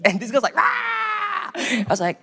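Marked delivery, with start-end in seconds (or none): 0.6-1.5 high, shrill acted shriek; possessed girl shrieking mid-prayer; mock demonic scream.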